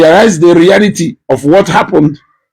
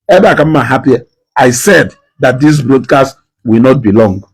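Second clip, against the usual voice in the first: normal talking voice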